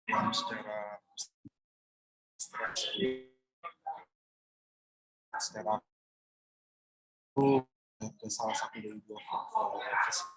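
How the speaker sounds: words breaking up online; bad connection. Real, not acted. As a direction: voice dropping out on a weak signal. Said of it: "We lost him".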